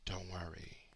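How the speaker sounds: a soft, breathy whisper of comfort; hushed, gentle don't worry; whispered reassurance. Real, not acted. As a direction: lean in and whisper it kindly. Soothing aside to the listener.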